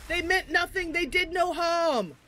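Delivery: high, impassioned dramatic plea; Ifan. Acted. Ifan defending innocent crabs, melodramatic plea. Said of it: "Ifan comes up and he's like".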